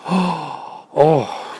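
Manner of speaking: gasping high delight; excited gasp. Acted. Pig rolls double sixes, gasping excitedly. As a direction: gasp at the lucky roll. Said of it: "would he get excited".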